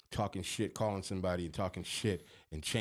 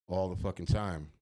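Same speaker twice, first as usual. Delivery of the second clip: ordinary talk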